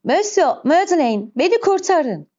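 high-pitched anxious plea for rescue; frightened, pleading call, pitched well up; anxious cry. A narrator's reading. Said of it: "endişeyle bağırdı".

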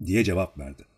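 ordinary delivery

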